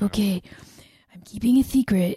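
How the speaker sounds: half-whispered, lisping secret-keeper; hushed comic character voice; McLovin voice. Acted. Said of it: "That was your McLovin voice".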